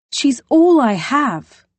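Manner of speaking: slow, high, loud plea; heartfelt. Acted. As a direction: say it as her only anchor. Poppy about her sister.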